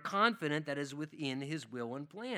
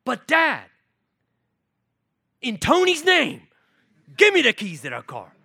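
put-on whiny child; 7 semitones higher, 13 dB louder than usual. Child begging dad for car keys.

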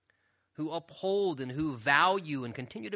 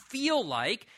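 "feel like" leaned on with a lifted pitch; stress on "feel like"; 7 semitones higher, 4 dB louder than usual. Real, not acted. Preacher tells congregation why we wrestle, stressing "feel like". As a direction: press on the words "feel like". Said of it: "I stress the word feel like".

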